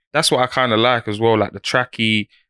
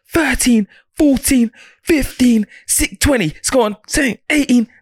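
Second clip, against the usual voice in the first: barking gym coach; 8 semitones higher, slower than usual. Real, not acted. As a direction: hype up every rep like a coach. Counting press-ups for friend.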